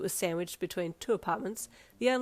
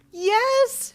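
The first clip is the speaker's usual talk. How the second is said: much higher, loud shriek of joy; scream; 13 dB louder than usual. Acted. Mother confirming acceptance, high joyful scream. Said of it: "she screamed".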